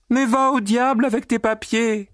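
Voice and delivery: very high, brash and mocking; insolent laughing retort. A narrator's reading. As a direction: laugh him off rudely. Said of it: "avec un rire insolent".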